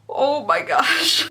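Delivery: a slow, disbelieving oh my gosh at the nail polish; drawn-out oh my gosh. Real, not acted. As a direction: stretch out a slow, amused oh my gosh. Nail polish reveal reaction.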